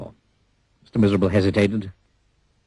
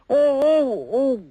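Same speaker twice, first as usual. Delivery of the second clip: high, loud ow-ow-ow; pained yelps; 3 dB louder, much slower than usual. Acted. Mister Happy yelps high as his foot is stepped on. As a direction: yelp as your foot is stomped.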